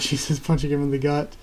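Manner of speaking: ordinary delivery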